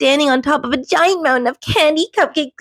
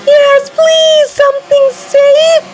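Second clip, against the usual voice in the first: much higher, louder pleading; begging; 8 dB louder, much slower than usual. A narrator's reading. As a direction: beg desperately for safety. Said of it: "begged Rocky".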